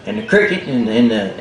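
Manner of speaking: plain reading voice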